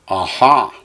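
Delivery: "aha", accent landing firmly on the final "ha"; stress on last syllable. Shown as a demonstration. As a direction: punch the second syllable of aha. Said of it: "you accent the last syllable".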